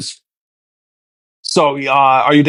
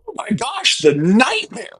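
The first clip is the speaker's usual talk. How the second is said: mock horror; 7 semitones higher, 4 dB quieter, slower than usual. Mock horror at having one sibling.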